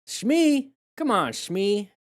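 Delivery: high-pitched mock summons; high, drawn-out playful call. Calling out a movie-style name.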